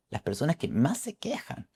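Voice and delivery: hushed confidence; whisper. Real, not acted. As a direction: drop to a secretive whisper. Confidencia susurrada. (confidencia en susurro)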